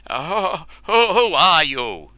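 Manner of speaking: high, loud frightened stammer; stammering in fear. A narrator's reading. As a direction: trip over the first word in panic. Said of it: "stammered Cernus".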